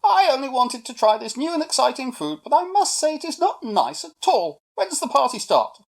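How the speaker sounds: high, loud, blunt monkey; very matter-of-fact. A narrator's reading. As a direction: state the complaint briskly and without apology. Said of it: "very matter-of-fact said".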